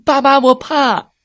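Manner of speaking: put-on high, loud scared child cry; frightened shout. Cub Kaka hides behind his father from the snake, high frightened shout. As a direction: yell for dad from behind him. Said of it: "卡卡跳到爸爸身后大喊".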